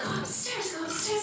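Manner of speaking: a hint of excited giggly crew. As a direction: mimic giggly coworkers urging you on. Effects crew voiced, giggling.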